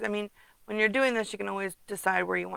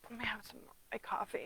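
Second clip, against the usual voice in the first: whisper; 13 dB quieter, faster than usual. Real, not acted. Aside while sipping coffee.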